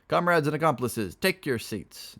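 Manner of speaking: loud, high call to the room; announcing. Acted. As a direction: announce it like a rowdy host. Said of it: "Dolt announced".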